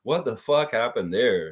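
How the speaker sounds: usual speaking voice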